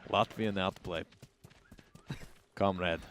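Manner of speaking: put-on a hammy, low Latvian accent. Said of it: "if that's a Latvian accent at all".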